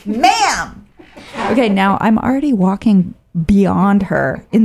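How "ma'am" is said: high, insistent calling out "Ma'am!"; a shrill-free but raised, officious call; bossy stranger. Retold or imitated. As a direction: nag her like a rule-enforcing hiker. Stranger calling after hiker.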